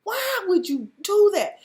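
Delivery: dramatic lament for Jeff; outraged plea. Real, not acted. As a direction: cry out on Jeff's behalf.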